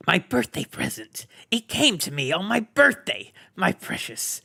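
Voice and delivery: hissing creature, fading to a whisper on precious; Gollum, covetous. A narrator's reading. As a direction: gloat over the ring, then breathe it. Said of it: "So he had said to himself".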